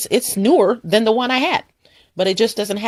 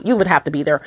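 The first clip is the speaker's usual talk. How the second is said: ordinary talk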